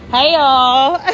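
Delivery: loud, high greeting holler; calling out. Real, not acted. Greeting friends at the pool mid-sentence, calling out. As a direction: holler hello to the group.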